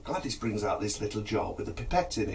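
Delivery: normal talking voice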